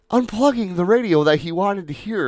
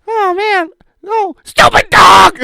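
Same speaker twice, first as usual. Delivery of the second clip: high squeaky shout at the dog; hammed-up falsetto shout; mock falsetto yell; 11 dB louder, slower than usual. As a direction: screech it like the wife losing it. Wife yelling at dog.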